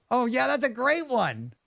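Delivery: high-pitched, delighted gushing; excited thanks. Real, not acted. Streamer thanking viewers for their support, excited. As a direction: gush with real delight, pitched well up.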